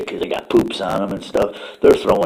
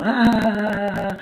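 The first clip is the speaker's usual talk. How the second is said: mock laugh; 14 semitones higher than usual. Fart laughing while running away.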